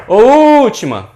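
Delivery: huge stretched U at the start of "última"; calling out the tonic syllable. Shown as a demonstration. Teacher knocks at the door to test stress, shouting "última" with the first vowel held. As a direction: holler "última" with a very long, high, loud first U. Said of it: "bate lá na porta".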